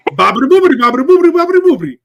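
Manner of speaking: loud, high mock-Italian gibberish; freaking-out fake Italian. Retelling a cartoon man claiming he speaks Italian, frantic mock Italian. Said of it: "the guy is freaking out in Italian".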